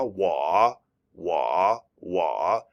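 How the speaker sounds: wah with a low dipping third tone, said three times. Shown as a demonstration. Teacher showing students the dipping tone, low dipping wah. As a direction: let wah dip low in the voice. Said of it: "It means I have a dip in my voice".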